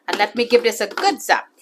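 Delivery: usual speaking voice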